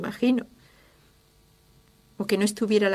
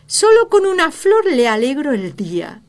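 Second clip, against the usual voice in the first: louder, higher joyful exclaim; light up over a single flower; delighted wife; 6 semitones higher, 10 dB louder than usual. Retold or imitated. (esposa encantada con una flor, más fuerte y aguda)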